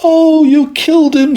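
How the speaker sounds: high, loud distressed cry; upset little girl. Acted. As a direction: wail at her brother in dismay. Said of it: "She was so upset".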